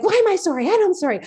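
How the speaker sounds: high-pitched retold indignation; upset protest. Balking at apologizing to her, upset protest. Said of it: "I was upset".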